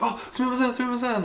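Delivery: high-pitched, hurried Japanese apology; apologetic lady. Retold or imitated. Imitating a lady who nudged her on the tram, high apologetic voice. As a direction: imitate a flustered woman apologizing.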